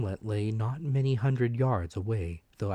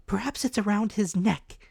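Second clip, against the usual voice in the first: higher, gently suggesting mother; 12 semitones higher, 4 dB louder than usual. A narrator's reading. Mother suggesting to Jim, in a higher voice. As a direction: offer the idea to Jim. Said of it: "suggested my mother".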